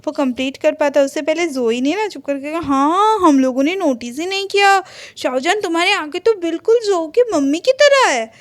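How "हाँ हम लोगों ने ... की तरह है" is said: high, loud excited retelling; excited interjection. Retold or imitated. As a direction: jump in eagerly, pitched up and louder. Girl cuts in with excited remark. (उत्साह से बीच में बोलना)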